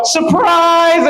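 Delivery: high mock threatening surprise; menacing surprise. Coup plotters ambushing the president, mock menacing surprise. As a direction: say it like a gun is drawn. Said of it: "they go".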